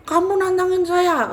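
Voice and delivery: high, loud spirit taking offence; tantangan marah. Retold or imitated. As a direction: act the spirit's outraged challenge. Acted quarrel with the spirit. (menantang dengan marah, suara tinggi keras)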